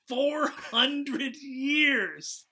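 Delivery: slow drawn-out correction. Real, not acted. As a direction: stretch out each word, slowly.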